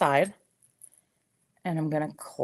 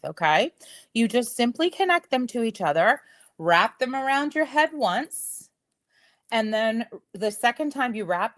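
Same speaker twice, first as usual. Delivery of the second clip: ordinary talk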